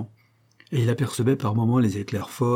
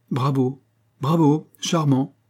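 lightly voiced smooth applause, a little higher; drawn-out, teasing compliment; suave intruder applauding; 4 semitones higher, 3 dB louder, slower than usual. A narrator's reading. Man surprising a pianist from behind, suavely teasing.

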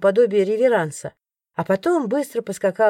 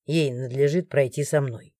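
lower, softer, firm male voice; guard interrupts; 7 semitones lower, 4 dB quieter than usual. A narrator's reading. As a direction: cut in low and firm. Morgan, the guard, speaks. (низко и твёрдо перебивает)

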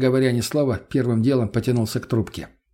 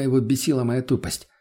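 ordinary delivery

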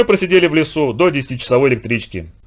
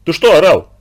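loud, higher urgent question; alarmed demand; 5 semitones higher, 6 dB louder than usual. A narrator's reading. Teacher running up to the shaking boy, loud alarmed demand.

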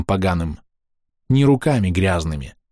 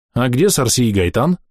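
surprised; 6 semitones higher, 3 dB louder than usual. A narrator's reading. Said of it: "удивлённо спрашивает мальчишка".

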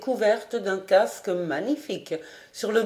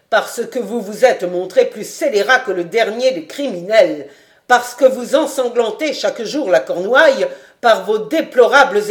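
louder and louder accusation; rising tirade; 8 dB louder than usual. A narrator's reading. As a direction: build the charge to a roar. Marshal berates the bandit. (le maréchal accable le baron, colère montante)

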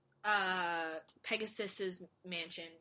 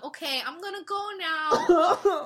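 laughing quote; 8 semitones higher, 12 dB louder than usual. Retold or imitated. Mock quote while laughing.

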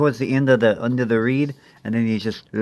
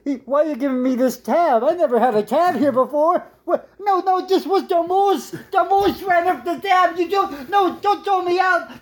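much higher, frantic protest; pitched-up frantic plea to the bartender; panicked man. Acted. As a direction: beg not to be thrown out. Pleads with the bartender.

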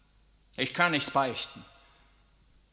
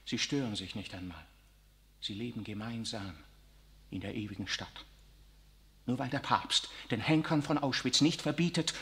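normal talking voice

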